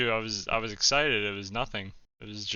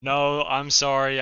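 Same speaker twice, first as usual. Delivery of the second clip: loud flustered apology; 3 semitones higher, 8 dB louder, slower than usual. Real, not acted. Accused of speaking Russian.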